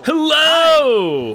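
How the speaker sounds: high, loud drawn-out hello; booming hello. Pretend. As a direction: greet like a grand entrance. Big mock greeting to co-host.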